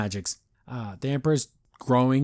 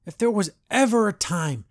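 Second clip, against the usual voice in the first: ordinary talk, nothing marked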